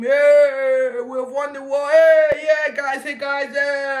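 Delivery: loud, high, whooping celebration; victory cheer. Acted. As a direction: shout the win to the crowd. Crowd hails returning victor.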